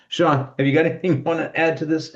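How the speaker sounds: tickle in the throat, voice catching; speaking through a throat tickle; frog in throat. Real, not acted. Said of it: "I've got a tickle in my throat".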